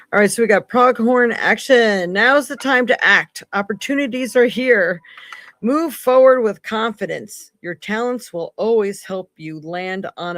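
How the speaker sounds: rally the viewers with it; hyped oracle card read; excited card reading. Real, not acted. Reading card message with energy.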